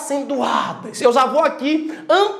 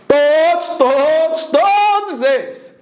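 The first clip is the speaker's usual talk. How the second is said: "todos" stretched and punched each time; emphatic repetition; 2 semitones higher, slower than usual. Shown as a demonstration. Teacher insisting the rule covers every word, stressing "todos". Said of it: "Eu disse todos".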